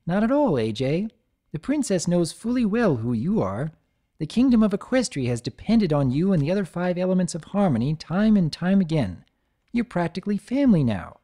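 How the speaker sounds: bright reassuring princess pony; cheerful, kind reassurance; lightly voiced friendly unicorn; Twilight voice.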